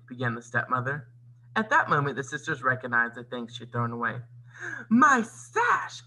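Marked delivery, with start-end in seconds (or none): 4.9-6.0 high, loud acted wail. A narrator's reading. Anastasia spots her sash on Cinderella, wailing. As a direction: wail over a stolen treasure. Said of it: "Anastasia wailed".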